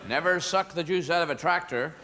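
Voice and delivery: lightly voiced yokel, drawn-out call; a hint of country bumpkin warning the kids. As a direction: call out slowly like a mock yokel. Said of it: "country kind of yokel character".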